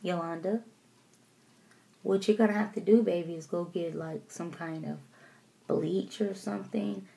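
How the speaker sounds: calm mom. Retold or imitated. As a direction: soothe her daughter without raising your voice. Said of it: "my mom doesn't yell".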